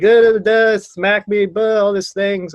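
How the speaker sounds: high, loud hammed-up crying babble; mock blubbering. Sobbing bar back explaining himself to the bartender, mock blubbering. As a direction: blubber half-formed words in a mock wail. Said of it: "I'm, like, crying".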